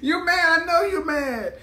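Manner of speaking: high-pitched teasing through laughter; cackle, then taunt in a high voice; laughing taunt. Real, not acted. Streamer mocking men who are mad, laughing taunt.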